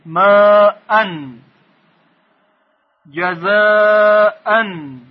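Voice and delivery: drawn-out teacher recitation; slow recitation. Shown as a demonstration. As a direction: hold the long a in ماءن before the -an. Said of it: "بعد از اون دیگه الف نمیاد".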